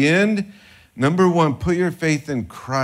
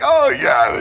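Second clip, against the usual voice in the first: higher and louder, lit up; a believer suddenly moved; joyful recognition; 11 semitones higher, 6 dB louder than usual. Retold or imitated. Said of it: "they're like".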